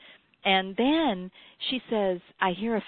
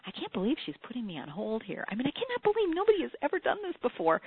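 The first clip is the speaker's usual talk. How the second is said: higher, fast incredulous aside; retold disbelief; 6 semitones higher than usual. Host complaining mid-interview, rising fast in disbelief. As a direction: mutter your outrage under your breath, then let it climb. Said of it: "I'm saying".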